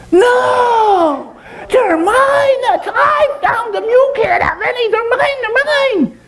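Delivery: very high squeaky monkey shriek; outraged falsetto monkey; possessive monkey. Acted. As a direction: squeal in protest like a greedy little monkey. Monkey refuses to share.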